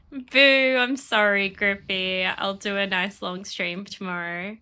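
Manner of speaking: ordinary talk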